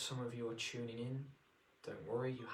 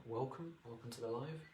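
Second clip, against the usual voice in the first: ordinary talk, nothing marked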